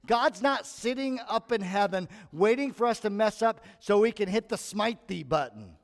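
normal talking voice

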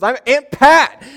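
high, loud, drawn-out imitated exclamation; indignant reveal. Great aunt telling nephew who she is, indignant. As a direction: belt it out as the offended aunt.

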